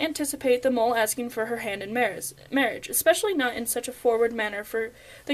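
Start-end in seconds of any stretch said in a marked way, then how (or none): none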